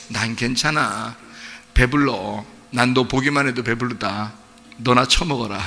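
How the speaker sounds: low, soft, grumbling parent; mock weary parent. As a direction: hide the hurt, brush the child off. Toothless parent declining meat. (투덜대는 부모 흉내)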